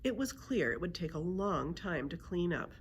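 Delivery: usual speaking voice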